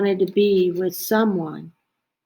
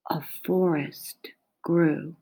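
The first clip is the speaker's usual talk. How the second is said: softer, hushed whisper of wonder; 4 semitones lower, 5 dB quieter than usual. A narrator's reading. Reading to a child, whispering the forest growing. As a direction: breathe it like a secret.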